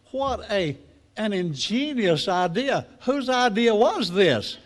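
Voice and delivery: high, loud, slow holler up to the sky; hollering farmer. Retold or imitated. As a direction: call up to the flying frog in amazement. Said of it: "he hollers up there and says".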